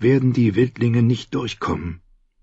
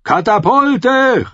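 high, drawn-out shouted order; calling out; 12 semitones higher, 3 dB louder, much slower than usual. A narrator's reading. Commander orders catapults into action, calling out. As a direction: bellow it to the crews. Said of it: "rief er".

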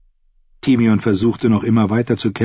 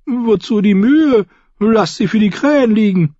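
complaining jester; 13 semitones higher, 4 dB louder than usual. A narrator's reading. The fool asks why bother with graves, high complaint.

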